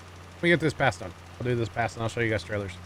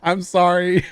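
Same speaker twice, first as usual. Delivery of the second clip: louder, higher sheepish sorry; loud apology; 7 semitones higher, 8 dB louder, slower than usual. Real, not acted. Streamer caught out by his co-host, loud sheepish sorry. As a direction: blurt it out, caught red-handed. Laughing apology after scolding.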